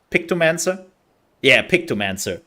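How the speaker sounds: ordinary delivery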